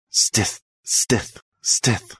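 soft whispered chirps; breathy little bird peeps; cartoon bird voice. A narrator's reading. Birds chirping in branches.

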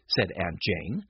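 ordinary delivery

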